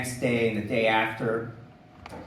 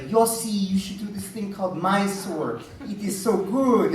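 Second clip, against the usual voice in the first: lightly higher eager friend voice; Italian friend Elena; 6 semitones higher than usual. Retold or imitated. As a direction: pitch it up a little, eager and insistent. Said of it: "She's like".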